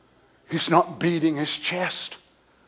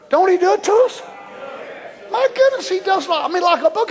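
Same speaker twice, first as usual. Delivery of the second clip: rising fervour; 11 semitones higher, faster than usual. Real, not acted. Preacher stirring the congregation, high and fervent. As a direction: push the crowd higher.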